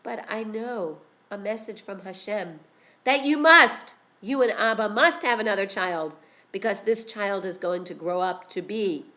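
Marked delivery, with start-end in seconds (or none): 3.0-6.2 loud, pressing plea on must; urgent insistence. Acted. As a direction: Miriam insisting to her mother, loud and urgent. Miriam urging her mother.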